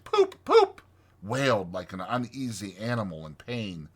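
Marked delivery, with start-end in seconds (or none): 0.0-0.8 high imitated car horn; motor horn toot. A narrator's reading. Car horn wailing out of the dust, high hooting imitation. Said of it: "from out the dust a faint".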